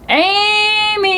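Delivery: a high, sung-out call up the stairs; loud yell. Acted. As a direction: call her name up the stairs, high and long. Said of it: "yelled as loudly as he could".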